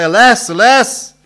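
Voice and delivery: high, loud, slow lament; wailing cry. Acted. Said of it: "in all the streets they cry".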